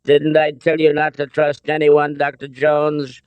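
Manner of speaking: smug villain. Acted. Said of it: "Donovan smiled benignly".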